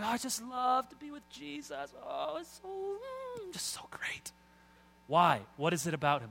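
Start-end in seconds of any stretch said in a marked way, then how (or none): 0.0-4.3 very high, swooning devotion fading into airy murmur; mock gushing believer. Believer talking about Jesus, mock gushing. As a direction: gush dreamily, trailing into wordless bliss. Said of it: "Some of us talk that way".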